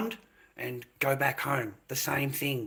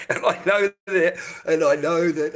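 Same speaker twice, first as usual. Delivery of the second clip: laughing; 5 semitones higher, 8 dB louder than usual. Real, not acted. Laughing at being old.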